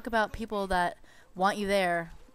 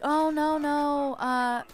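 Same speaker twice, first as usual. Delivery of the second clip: nail guy; 5 semitones higher, slower than usual. Retold or imitated. Nail guy brushing her off.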